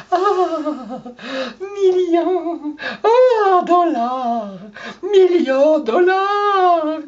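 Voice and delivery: high, drawn-out swooning over money; hammed-up longing cry over dollars; mock greedy gold-digger swoon. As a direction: moan the millions like a dreamy gold-digger. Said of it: "tu penses dans ta tête".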